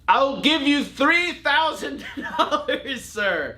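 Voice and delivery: high, slow, hammed-up posh bidder breaking into laughter; mock gentleman buyer. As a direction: play a grand gentleman throwing money around, half laughing. Mock pompous offer to seller.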